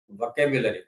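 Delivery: "વોકેબ્યુલરી" repeated as vo-KE-byu-la-ri; demonstrated pronunciation. Shown as a demonstration. Teacher answering own question on pronunciation, "વોકેબ્યુલરી" as vo-ke-byu-la-ri. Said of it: "એનો ઉચ્ચાર થાય".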